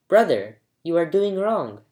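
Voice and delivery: ordinary delivery, nothing marked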